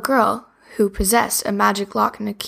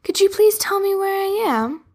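put-on high, lost little girl; 9 semitones higher than usual. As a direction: ask sweetly, bit unsure. Sophie voiced by child narrator.

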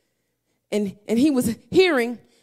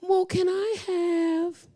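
put-on coaxing child; 5 semitones higher, 6 dB quieter, much slower than usual. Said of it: "daughters asking".

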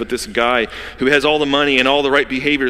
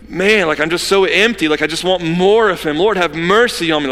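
crying out; 6 semitones higher, 4 dB louder than usual. Acted. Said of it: "crying out to him".

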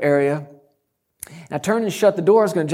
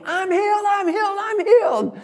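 a shouted claim held on one high note; mock shout. Shown as a demonstration. Preacher showing that volume won't heal, hammed-up mock shout. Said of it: "me shouting".

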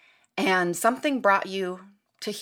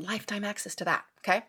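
ordinary delivery

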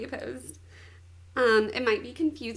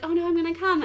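higher, louder mock-climax cry; mock aroused alarm; 6 semitones higher, 7 dB louder, much faster than usual. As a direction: voice her sudden panic, playfully acted. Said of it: "she gets really turned on".

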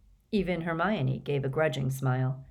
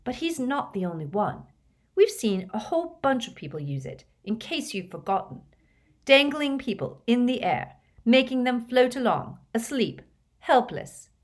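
Hermione's voice; 7 semitones higher than usual. A narrator's reading. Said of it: "said Hermione".

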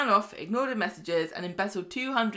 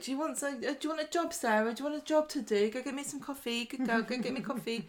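lightly voiced bossy Carla, higher and softer; a hint of patronising Carla ordering Sarah about; 5 semitones higher, 5 dB quieter, faster than usual. Podcaster imitating Carla sending Sarah for coffee, lightly mocking. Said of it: "And so Carla's like".

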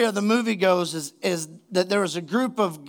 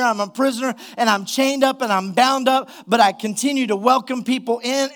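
raised, driving sermon delivery; impassioned preaching; 4 semitones higher than usual. Real, not acted. Preacher urging the congregation to live unhindered, impassioned. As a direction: preach it with urgency, pitch held high. Preaches the application at full force.

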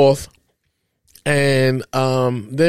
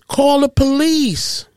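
mock distressed yell; 9 semitones higher, slower than usual. Father mimicking the ex-wife calling for police, mock yell. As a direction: imitate a damsel in distress calling out slowly. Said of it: "She yells out".

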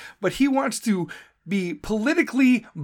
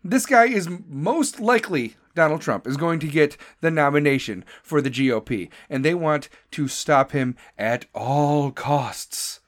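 normal talking voice

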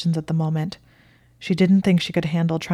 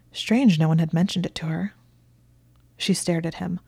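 usual speaking voice

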